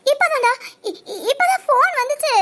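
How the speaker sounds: high, stammering fake panic; badly faked shaken voice; acted distress. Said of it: "உன் நடிப்பெல்லாம்". (பாதி சிரிப்புடன் நடிப்பு பதட்டம்)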